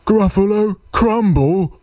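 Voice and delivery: high, startled echo rising at the end; alarmed monster question. Acted. Gruffalo hears he will be eaten, high alarmed question. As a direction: monster squeaking in disbelief.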